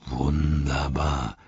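low, slow mock praise; low gloating orc; grinning sarcasm. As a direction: drawl it through a grin. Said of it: "grinste Ugluk".

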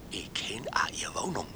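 secretive whisper; hushed confession of a secret. Acted. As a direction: breathe it like a secret. Confiding secret dream encounter.

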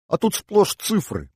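very high, slow exclamation; high-pitched astonishment; puzzled surprise. Acted. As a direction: react with baffled disappointment. Girl surprised to find only figures.

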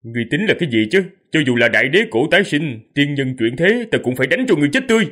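fast, rising furious retort; angry shout. Acted. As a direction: spit it out, building to a shout. Hero defies villain.

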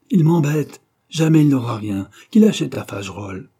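put-on old painter roaring after the dealer leaves; thundering voice. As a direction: let it burst out in pain and anger. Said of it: "de sa voix tonnante".

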